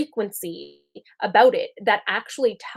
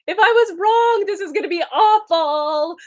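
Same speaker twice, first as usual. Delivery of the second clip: much higher, louder, panicky worry; mock-frantic catastrophizing; anxious ego voice; 8 semitones higher, 6 dB louder than usual. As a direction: play the fearful inner voice spiraling. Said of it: "the ego is gonna make us think".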